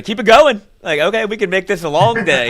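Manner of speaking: high, loud coach taunt; imitating a coach threatening the team; mock coach yell. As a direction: holler at the runners like a coach. Acting coach threatening more running.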